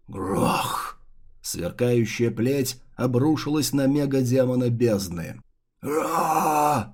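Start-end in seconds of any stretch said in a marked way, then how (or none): 0.1-1.0 monster roar. Acted. Monster struck by lightning. 5.8-6.9 a strained, tearing beast roar; deep distorted monster roar. Acted. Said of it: "надрывно взревел он".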